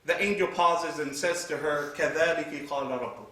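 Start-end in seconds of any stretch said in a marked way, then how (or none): 2.0-3.3 rapid chanted Arabic recitation; Quranic recitation. Acted. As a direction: recite the verse quickly.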